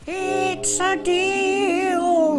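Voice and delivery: high, very slow possessed drone; weird quivery voice. Acted. Possessed Laban bargaining with Jacob, high and very slow. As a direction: let the gods speak through him, drawn out. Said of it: "in his weird, quivery voice".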